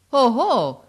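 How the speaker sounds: loud, high, outraged "oh, oh"; angry exclamation. A narrator's reading. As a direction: burst out in fury. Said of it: "esclamò Votini pien di rabbia".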